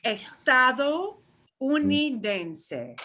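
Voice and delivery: Estadounidense stretched out slowly, syllable by syllable; slow model pronunciation. Shown as a demonstration. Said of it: "If you absolutely cannot get out estadounidense".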